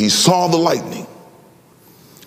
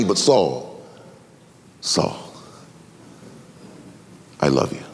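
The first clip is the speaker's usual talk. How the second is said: low, softer, half-whispered love; tender whisper; 13 semitones lower, 6 dB quieter than usual. Acted. Jesus telling his persecutor Saul he loves him, hushed and tender. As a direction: breathe it like a secret confession of love.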